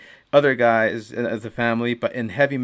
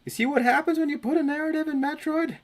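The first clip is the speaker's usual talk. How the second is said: much higher, put-on squeaky question; mock high voice; 14 semitones higher than usual. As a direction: pitch it way up as a joke. Joking mock scolding about Metroid.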